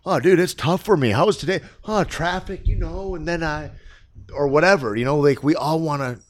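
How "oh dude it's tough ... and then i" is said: high, put-upon whine about traffic; mock a self-pitying complainer, higher; whiny complaining. Friend parodying people who complain about their day, mock whine. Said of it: "we can get caught in the drama".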